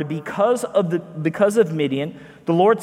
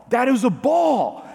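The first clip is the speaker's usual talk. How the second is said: imitated yelling; 5 semitones higher than usual. Son arguing with his dad over a strike call, imitated yelling. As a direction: imitate a kid's insistent protest without real volume. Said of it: "he kept yelling at me".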